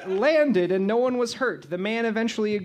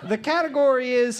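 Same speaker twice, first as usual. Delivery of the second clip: normal talking voice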